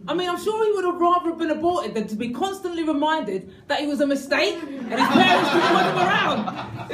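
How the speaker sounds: ordinary talk, nothing marked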